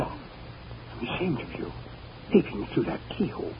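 hushed scolding whisper. Acted. Husband whispers a rebuke to his wife at the keyhole. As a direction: scold her without being overheard.

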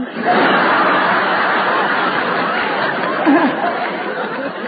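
breathy laugh; long laugh. Real, not acted. [name] laughs at the accent joke.

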